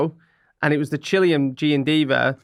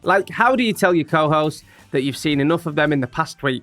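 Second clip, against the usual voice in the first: podcast intro; 4 semitones higher than usual. Acted. Host opening the podcast for listeners, announcer voice. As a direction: open the show like a presenter.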